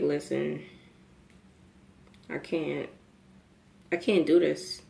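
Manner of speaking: low, soft, with long pauses; drained confession. Retold or imitated. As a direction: quietly admit defeat, haltingly. Retelling giving up on porch.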